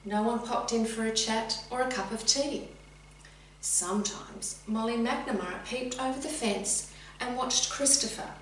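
usual speaking voice

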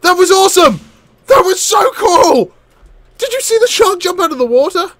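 very high, loud excitement; thrilled shouting. Real, not acted. Player after the shark jumps out, thrilled shouting. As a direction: shout it out, bursting with delight.

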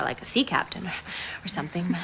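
whispering. Acted. Whispered aside in vows.

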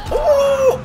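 very high-pitched "ooh"; high whoop. Real, not acted. Reacting to action in game.

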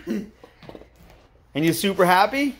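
put-on high, sing-song voice for a toddler; baby talk. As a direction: coo at the little one, high and bright. Dad greets toddler in baby talk.

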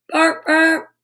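high, punchy toy-car honk; two short spoken horn toots; car horn imitation. A narrator's reading. Naughty's car arrives at the square, honking. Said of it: "they heard a familiar".

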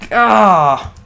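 loud frustrated "Ah"; frustrated yell. Real, not acted. Gamer fed up after dying, loud frustrated yell. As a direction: vent the aggravation in one loud cry. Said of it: "so aggravating".

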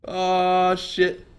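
loud high yelp; startled curse, still shaken; scared yelp. Real, not acted. Streamer still spooked by the chair, yelping.

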